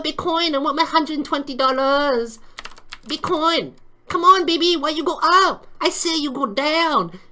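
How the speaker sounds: high, loud put-on voice yelling at the market; pleading trader character. Trader yelling at Bitcoin to go down, high put-on voice. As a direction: beg Bitcoin to crash, in character.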